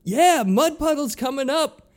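high, loud stage announcement; insisting to the crowd. Retold or imitated. Singer insisting to the crowd the headliner is coming, loud and high. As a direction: sell it to the crowd.

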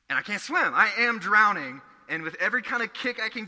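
urgent storytelling. Real, not acted. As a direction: relive the panic of going under. Climax of drowning story.

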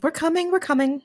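high-pitched, hasty call back; hurried placating call. Acted. Answering the impatient van driver, hurried high call.

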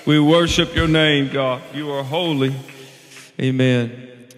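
loud, ringing worship prayer; fervent prayer. Acted. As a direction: pray it out over the congregation. Pastor praying aloud before sermon.

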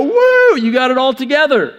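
high whooping cheer, then quick praise; mock applause. Church members applauding the rule keepers, high mock whoop. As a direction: cheer someone on with exaggerated approval.

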